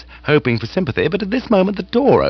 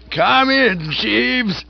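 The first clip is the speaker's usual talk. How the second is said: bellow; 12 semitones higher, much slower than usual. Acted. Aunt bellowing at Jeeves to come in. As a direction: call him in with a hearty bellow. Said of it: "bellowed the aged relative".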